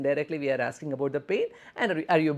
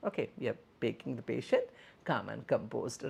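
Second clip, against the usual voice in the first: normal talking voice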